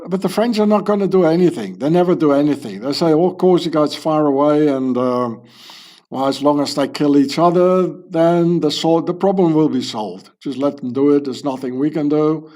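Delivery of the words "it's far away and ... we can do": ordinary talk, nothing marked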